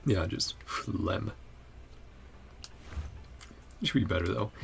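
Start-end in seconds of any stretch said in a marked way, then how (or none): none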